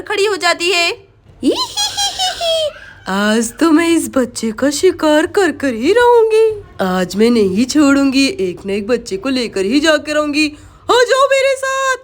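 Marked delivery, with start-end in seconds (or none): 1.4-2.8 high, drawn-out evil cackle; witch's cackle. Acted. Witch appears behind Sonam. 3.1-10.5 menacing witch. Acted. Witch vows to take a child. 10.9-12.0 high, loud witch's call; witch luring prey. Acted. Witch calls the child to her.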